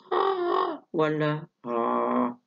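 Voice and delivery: first ah held high, second ah held low; two steady sung tones, high then low; high versus low pitch. Shown as a demonstration. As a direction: contrast the pitch, keep the loudness even. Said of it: "بس واحد طبقة عالية".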